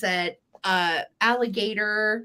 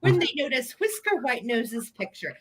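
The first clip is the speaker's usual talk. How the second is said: high, singsong storybook voice; exaggerated high storyteller voice; children's story reading; 9 semitones higher than usual. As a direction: read it like a silly nursery tale, pitched up. Reads a children's story aloud.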